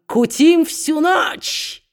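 high, drawn-out revelling shout; rowdy cry. Acted. Quilp greeting the lantern, pipes and grog, rowdy cry. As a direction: call it out slowly, pitch soaring. Quilp rowdily calls for carousing.

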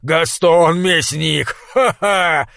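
блеющий безумный трактирщик, медленно и громко; растянуто проблеять, как обезумевший толстяк; блеющий голос. A narrator's reading. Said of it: "проблеял он". (блеющий безумный голос)

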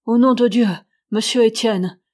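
loud, frightened outburst, voice breaking with fear; a terrified plea, loud; stammering in terror. A narrator's reading. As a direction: cry out to him in dread. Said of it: "balbutia-t-il".

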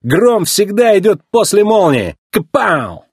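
slow, high, cocky taunt; snappy retort. A narrator's reading. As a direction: show off with a catchphrase. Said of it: "огрызнулся смелый дебютант".

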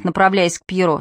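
ordinary delivery, nothing marked

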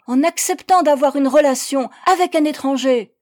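loud, high denunciation; angry accusation. A narrator's reading. Persecutor accuses her of loving a foreigner, angry. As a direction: hammer out the charge.

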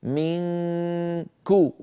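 منكو drawn out very slowly, the nasal hum held before a crisp كو; model recitation, ikhfa. Shown as a demonstration. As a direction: slowly hold the nasal of منكو, then land on كو. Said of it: "بل آتي إليه مباشرة".

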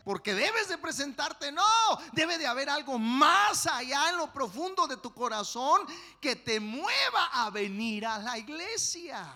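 loud, high sermon climax; fiery preaching. Acted. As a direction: preach it hard to the congregation. Preaching at full force.

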